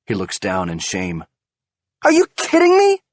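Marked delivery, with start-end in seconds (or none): 2.0-3.0 high, loud outraged disbelief. A narrator's reading. Wife learns of affair, loud outraged disbelief. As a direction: hurl it at him in fury.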